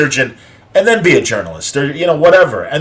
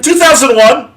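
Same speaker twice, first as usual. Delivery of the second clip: put-on chirpy young voice; 12 semitones higher, 5 dB louder than usual.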